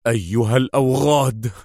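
understated anguished shout; pained, bitter Adham. A narrator's reading. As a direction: curse them through pain, without real volume. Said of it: "وهو يصرخ في ألم ومرارة".

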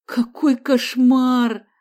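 loud, very slow horrified groan; moaning. A narrator's reading. Uncle hears of the robbery, moaning in horror. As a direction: drag it out as a high moan. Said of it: "застонал Мариан Самсонович".

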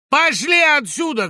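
high, loud, drawn-out yell; teenager's shout. A narrator's reading. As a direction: yell at them to clear off. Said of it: "крикнул из-под старой берёзы подросток". (подростковый крик)

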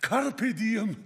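ordinary talk, nothing marked

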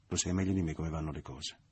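plain reading voice

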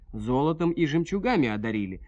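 ordinary delivery

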